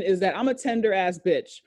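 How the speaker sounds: normal talking voice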